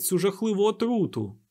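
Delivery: high-pitched urgent outcry; fervent, high exhortation; impassioned cry. A narrator's reading. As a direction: keep crying out to the crowd. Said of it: "заволав дикун".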